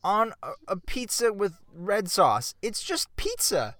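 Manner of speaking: high, slow incredulous whine; exasperated disbelief. Real, not acted. Scoffing at a friend who defends margherita, high and slow. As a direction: drag it out, baffled and fed up.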